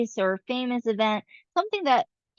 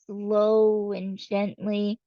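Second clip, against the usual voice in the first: slow and gentle; much slower than usual. Shown as a demonstration. Teacher showing how a slow poem reads, slowly drawn out. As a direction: let each word stretch, slow and gentle. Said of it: "if a rhythm allows a poem to be read really".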